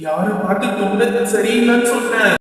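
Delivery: put-on offended spirit in a possessed girl; throaty ghost voice. Possessed student challenging teacher over the throat remark, ghost voice. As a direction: challenge them in a menacing ghost voice. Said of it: "தொண்டை கிண்டை சரியில்லையா உனக்கு?".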